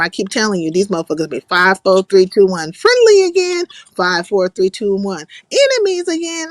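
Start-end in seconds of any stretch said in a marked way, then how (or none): 2.7-3.7 high, loud mocking call; exasperated mock proclamation, pitched way up; mock announcement. Mocking friends' sudden switches. 5.5-6.5 high-pitched mocking call; exasperated mock proclamation, pitched way up; mock announcement. Mocking friends' sudden switches.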